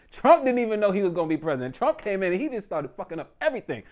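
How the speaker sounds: usual speaking voice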